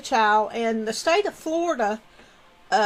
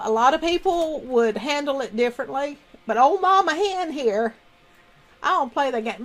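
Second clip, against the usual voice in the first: ordinary delivery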